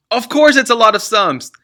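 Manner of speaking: father snapping at son; impatient snap. Acted.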